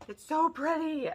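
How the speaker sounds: high-pitched squealed words; gushing delight. Real, not acted. Admiring pretty gifted makeup, high gushing delight.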